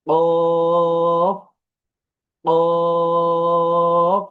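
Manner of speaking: কফ held long and loud from deep in the throat; deep qaf. Shown as a demonstration. Teacher demonstrating heavy qaf to class, loud. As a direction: knock it in the throat. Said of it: "গলায় একটি বাড়ি দেয়".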